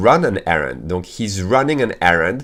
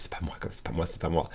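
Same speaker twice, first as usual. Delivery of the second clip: hushed denial; 3 semitones lower, 12 dB quieter, faster than usual. Retold or imitated. Retold panic when pulled over.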